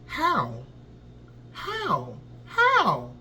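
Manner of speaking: baffled repeated how, last one laughing; incredulous how, breaking into a laugh; disbelieving how. Real, not acted. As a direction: ask how in stunned disbelief. Disbelief at the burner turning.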